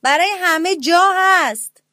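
high, loud call from inside the pumpkin; greedy brother calls the others into the pumpkin, loud and high; shouted call. A narrator's reading. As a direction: holler it cheerfully so they hear. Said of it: "داد زد".

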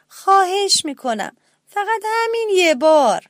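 little mouse pleads with his brother, high and insistent; pleading little mouse. A narrator's reading. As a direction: beg with wide eyes, pitched high.

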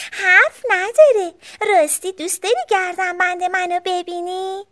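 put-on high little-girl bear voice; sister bear. A narrator's reading. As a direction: pipe up as a young sister bear.

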